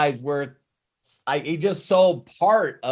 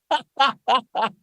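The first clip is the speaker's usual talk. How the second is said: laugh; 10 semitones higher than usual. Real, not acted. Laughing at Conor's answer.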